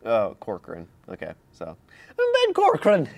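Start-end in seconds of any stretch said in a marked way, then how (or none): 2.2-3.1 high, mock Irish introduction; fake Irish accent. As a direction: announce yourself in a hammed-up Irish brogue. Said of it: "fake Irish accent that he does".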